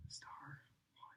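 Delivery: breathy unvoiced whisper close to the ear. Shown as a demonstration. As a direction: whisper right in his ear. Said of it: "I'm gonna whisper into his ear".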